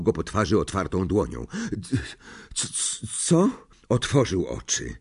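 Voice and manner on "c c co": faint stutter, then a higher question; dazed stammer. A narrator's reading. Harry comes round after fainting, dazed stammer. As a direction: stammer groggily before the word comes out.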